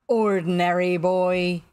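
loud, slow probing question; quizzing captain. A narrator's reading. Captain guesses at stranger's identity, probing. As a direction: boom the guess, stretching it out.

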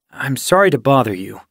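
ordinary delivery

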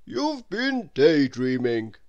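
teacher scolding a pupil; stern teacher. A narrator's reading. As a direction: tell him off slowly. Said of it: "said the teacher".

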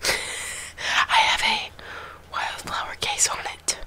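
hushed show and tell; whispering. Real, not acted.

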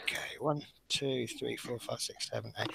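counting under her breath, whispered. Real, not acted. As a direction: whisper the numbers to yourself.

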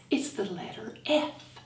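ordinary talk

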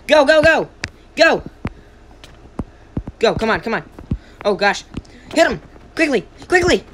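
loud high frantic cheering; cheering on a fight. Real, not acted. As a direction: yell them on to win.

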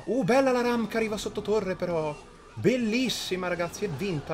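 high, loud excited cheer. Real, not acted. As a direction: celebrate the win out loud. Ram reaches tower, winning.

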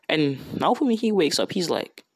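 plain reading voice

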